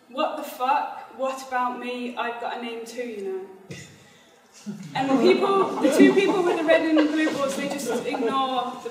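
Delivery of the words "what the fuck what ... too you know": normal talking voice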